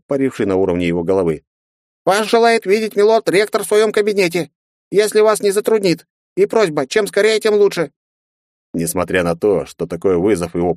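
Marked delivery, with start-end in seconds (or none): 2.1-7.9 very high, tiny polite voice; a tiny dragon messenger squeaking; squeaky baby dragon. A narrator's reading. Little dragon delivers message.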